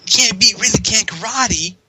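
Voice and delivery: high-pitched mock victory line; imitated game heroine. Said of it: "what was the line she would say".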